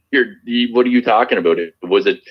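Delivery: ordinary delivery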